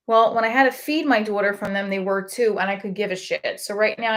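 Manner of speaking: normal talking voice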